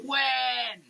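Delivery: a sudden call, flung out loud; shouted when. Acted. Reading the soap label aloud, a sudden shouted question. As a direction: demand the answer with a burst of voice.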